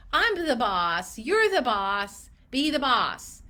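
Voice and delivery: slow, loud, punched phrases; emphatic assertion. Real, not acted. Coach telling clinicians to take charge, emphatic. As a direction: declare it slowly with authority.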